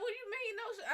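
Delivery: high, squeaky mock disbelief; imitating boyfriend. Quoting her boyfriend's shock about no sugar, high mock disbelief. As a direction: play him as squeaky and baffled.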